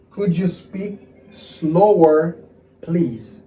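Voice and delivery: very slow, stretched-out request; careful, drawn-out teaching pace; slow model phrase. Shown as a demonstration. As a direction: draw out each word for learners.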